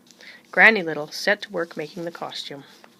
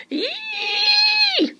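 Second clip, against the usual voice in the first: piercing scream of fright, far higher and louder; high shriek; 5 dB louder than usual. A narrator's reading. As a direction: shriek at the sight of a mouse. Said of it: "shouted Missus Newcomb".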